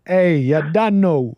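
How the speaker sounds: slow, drawn-out Jamaican patois imitation; Jamaican-Toronto accent. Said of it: "the way he speaks is so interesting".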